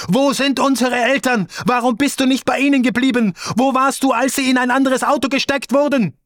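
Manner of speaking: high, shrill-free accusing sister voice; reproachful sister. Retold or imitated. Sister confronting her arriving brother, high and accusing. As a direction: hurl the questions like an accusing sister.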